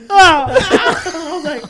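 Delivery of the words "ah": sudden high yelp of pain. Retold or imitated. Teammate struck between the eyes, sharp yelp. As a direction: cry out like the ball hit you. Player hit in face by ball.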